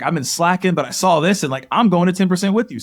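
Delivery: retold pledge with building excitement; fired up. Friend calls to join the challenge, fired up.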